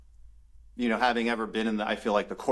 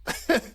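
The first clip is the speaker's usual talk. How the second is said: high laugh. Real, not acted. Laughs at dad's bowling offer.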